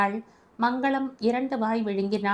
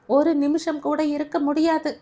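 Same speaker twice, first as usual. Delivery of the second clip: ஒரு நிமிஷம் raised high and loud; shouted refusal; 6 semitones higher, 4 dB louder than usual. A narrator's reading. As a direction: insist stubbornly. Grandmother repeats refusal.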